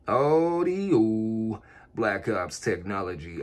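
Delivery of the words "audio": long stretched radio call; drawn-out call into the mic; over the radio. Real, not acted.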